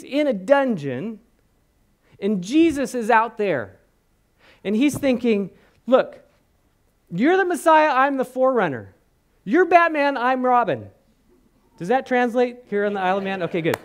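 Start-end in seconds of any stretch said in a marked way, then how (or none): 5.9-10.9 higher, louder pleading voice; John the Baptist pleading. Retold or imitated. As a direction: press Jesus on who's who. John voiced complaining in prison.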